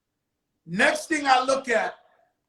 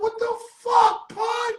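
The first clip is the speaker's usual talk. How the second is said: much higher, stunned cry of what the fuck; shocked disbelief; 12 semitones higher, slower than usual. Retold or imitated. Joe stunned to see Pun walk into the cell, high shocked cry. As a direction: squeal it out in total disbelief.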